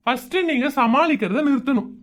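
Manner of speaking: usual speaking voice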